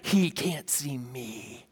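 very soft, drawn-out hush; child's secret thought. Retold or imitated. Toddler believes Daddy can't see her, slow secretive hush. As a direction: voice a hiding toddler's thought, slow and low-volume. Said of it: "And in her mind".

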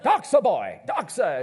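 a hint of a stern father drilling his boy; lightly voiced dad teaching Greek; Greek teacher dad. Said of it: "imagine what my family devotions look like".

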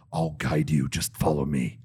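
a parking guy leading a driver to a special spot, low and hushed; low, quiet attendant voice. Retold or imitated. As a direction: drop the voice low and hushed like a shady guide.

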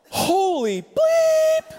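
high drawn-out cry with imitated censor beep; mock shocked outburst. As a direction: blurt it out slowly, sing the bleep very high. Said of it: "And she just goes".

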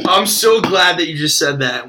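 ordinary talk

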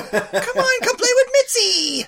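put-on high, slow, laughing little-girl plea; lightly hammed-up little sister begging; mock kid sister. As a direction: coax him to play in a high playful voice. Imitating childhood sister inviting.

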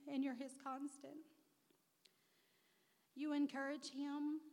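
fighting tears through the words; holding back tears. Real, not acted. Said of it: "and I'm trying not to cry".

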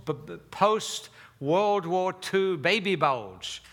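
ordinary talk, nothing marked

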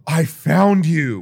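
slow, loud triumphant exclamation; excited old man. Retold or imitated. Old man finally finding the passport's owner, excited. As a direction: draw it out in relieved discovery.

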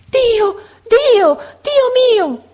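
very high, loud cries; screamed three times. A narrator's reading. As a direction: scream to heaven in overwhelming shock. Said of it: "La donna urlò tre volte".

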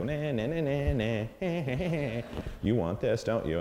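teasing singsong taunt; tempting devilish whisper. Retold or imitated. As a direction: taunt the puppy playfully. Teasing puppy with toy.

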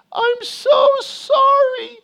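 put-on child's high, drawn-out screeching wail. Son crying out to parents across the house, screeching. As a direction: wail it loud and very high, dragging each word. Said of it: "those words screeching across the house".

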